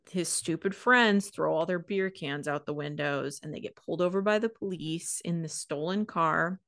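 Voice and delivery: ordinary delivery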